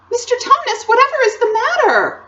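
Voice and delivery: put-on very high, loud worried girl voice; alarmed concern. A narrator's reading. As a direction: cry out in concern. Lucy alarmed by faun's tears.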